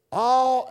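All drawn out and emphasized; stress on All. Retold or imitated. Preacher recalling the choir's song, stressing All. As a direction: hold the word All with emphasis. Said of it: "had great emphasis on the word all".

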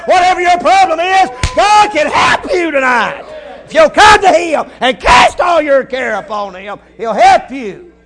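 rising, shouted preacher's climax, slow and high; a Southern preacher building to a shout; preaching at full force. Acted. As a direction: preach it out loud to the congregation.

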